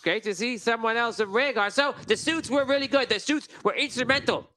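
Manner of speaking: slow, high, pompous nobleman; grandly introducing himself, a pompous gnome lord. Acted. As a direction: greet them like a self-important lord. GM voices Lord Wheaterton.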